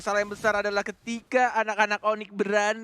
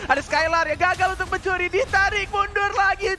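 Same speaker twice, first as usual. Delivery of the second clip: excited play-by-play; 6 semitones higher than usual. Real, not acted. Caster hypes a teamfight.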